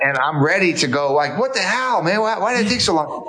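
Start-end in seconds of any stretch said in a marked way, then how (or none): 1.4-3.3 high-pitched exasperated mock outburst; retold crabby protest, much higher voice; cranky impatience. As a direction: act out an impatient demand, pitch way up. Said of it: "I'm so cranky".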